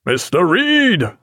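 high, drawn-out captain's hail; calling out. A narrator's reading. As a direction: call slowly across the deck to an officer. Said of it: "called Jack".

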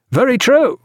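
high, eager cry of assent; hearty agreement called out; cried agreement. Acted. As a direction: chime in high and emphatically. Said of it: "cried Pullings and Granger".

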